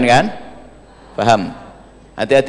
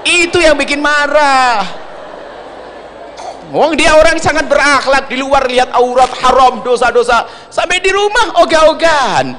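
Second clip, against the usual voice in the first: irritated husband; 10 semitones higher than usual. Retold or imitated. Preacher voicing a fed-up husband, high aggrieved complaint. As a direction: whine it as the pious husband fed up at home.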